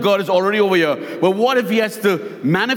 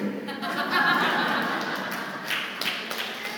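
quiet laugh; 9 semitones higher, 10 dB quieter than usual. Real, not acted. Preacher laughs at punchline.

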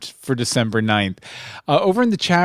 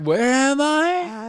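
very slow, higher-pitched dazed singer; 9 semitones higher, much slower than usual. Retold or imitated. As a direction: draw it out as if utterly lost. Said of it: "She was like".

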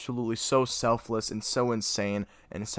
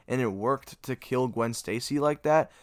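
ordinary talk

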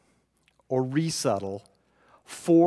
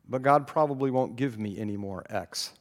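ordinary talk, nothing marked

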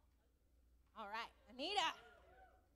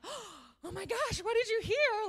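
much higher, louder and slower, thrilled; excited; 13 semitones higher, 10 dB louder, much slower than usual. Retold or imitated. A teacher thrilled as a pupil speaks up, excited. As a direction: burst out with delight at the child. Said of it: "I was so excited".

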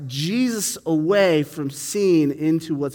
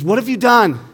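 louder, higher demanding questions; irritated Pilate; 6 semitones higher, 9 dB louder than usual. Retold or imitated. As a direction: press the prisoner impatiently. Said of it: "the last thing Pilate said to Jesus".

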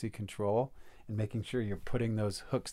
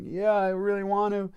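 imitated crying; 9 semitones higher than usual. Crying man answering the facilitator, imitated high wail. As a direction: act out a tearful, stretched-out admission. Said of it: "This, like, guy is crying".

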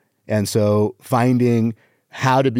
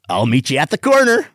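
higher punchline, jumping up at the end; emphatic joke delivery; 4 semitones higher than usual. Shown as a demonstration. Comic working on his delivery, exaggerated punchline. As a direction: send the last word up high. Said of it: "on the delivery".

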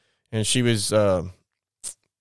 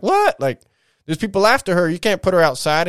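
high-pitched, incredulous reenactment; shocked protest, retold; 7 semitones higher, 4 dB louder than usual. Brother protesting to dad about his sister, shocked disbelief. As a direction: act out disbelief, pitch jumping up on the first word.

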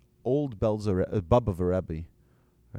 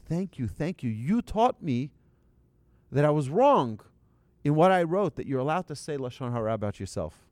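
raised, emphatic retold thanks; effusive gratitude; 6 semitones higher, 6 dB louder than usual. Rabbi comforting the man who slapped him, effusively grateful. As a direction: thank him emphatically, building louder, then easing off.

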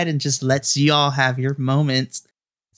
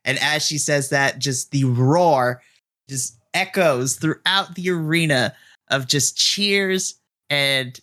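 usual speaking voice

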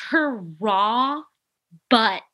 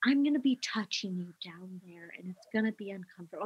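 soft gentle warning; 9 dB quieter, faster than usual. Retold or imitated. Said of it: "She's like".

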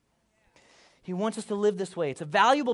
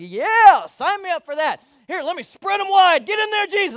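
much higher and much louder, gleeful; overeager Peter; 11 semitones higher, 14 dB louder than usual. Retold or imitated. Peter telling Jesus to wash everything, hammed-up excitement. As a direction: throw himself all in, hammed-up. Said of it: "he's like".

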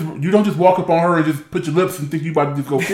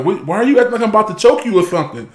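defensive; 7 semitones higher, faster than usual. Retold or imitated. Husband voices wife, acting out scene.

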